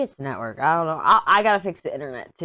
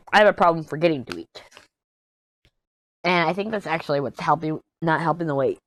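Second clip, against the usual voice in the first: ordinary talk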